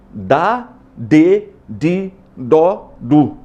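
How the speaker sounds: each syllable dá, de, di, dó, du said apart, as a drill. Shown as a demonstration. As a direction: run through the D syllables slowly. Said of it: "cinco alterações na letra D". (sílabas dá, de, di, dó, du bem separadas)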